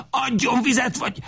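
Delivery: high-pitched, frantic cry; frantic demand. A narrator's reading. Lajos beside himself demanding water, frantic cry. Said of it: "kiáltja magán kívül".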